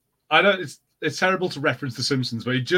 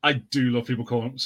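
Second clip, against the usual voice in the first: ordinary talk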